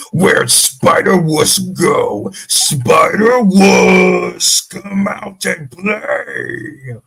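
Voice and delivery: slow, loud, drawn-out monster taunt; Venom voice impression. Shown as a demonstration. Asked to demo his Venom, slow booming taunt. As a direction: stretch each word out like a hulking symbiote calling its prey. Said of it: "Would you like to give an example?".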